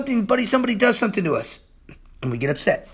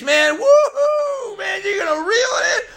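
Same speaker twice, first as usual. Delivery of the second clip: high, stretched woohoo shout; ecstatic yell; 13 semitones higher than usual. Real, not acted. Preacher celebrating a caught fish, ecstatic shout. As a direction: scream out the joy of the catch. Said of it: "I'm sorry I'm screaming and yelling".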